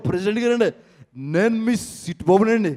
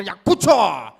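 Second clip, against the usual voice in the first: louder, curt order to sit; impatient command; 6 dB louder, slower than usual. Acted. Mimic orders the crowd to sit, louder.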